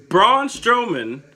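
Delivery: slow booming wrestler introduction; ring announcer intro. Pretend. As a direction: stretch the name for the arena. Announcing the next matchup.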